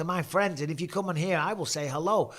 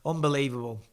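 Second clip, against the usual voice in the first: ordinary delivery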